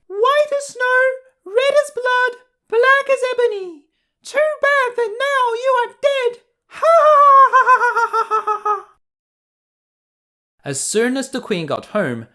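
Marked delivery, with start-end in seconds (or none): 0.1-6.4 very high, louder wicked queen; bitter gloating queen. Acted. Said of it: "laughed bitterly and exclaimed". 6.7-8.9 very high, loud witch laughter fading out; evil cackle. Acted. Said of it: "laughed bitterly".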